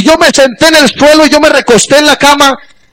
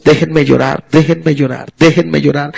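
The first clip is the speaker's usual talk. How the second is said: grieving plea; 7 semitones lower, 5 dB quieter than usual. Retold or imitated. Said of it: "No, es".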